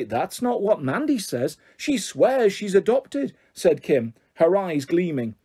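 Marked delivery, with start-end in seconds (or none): 0.0-3.3 slow, drawn-out mock innocence; gloating taunt. Bully threatens to tell Mandy's mum, gloating. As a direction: stretch every word to relish the threat. Bully rehearses telling on the girl.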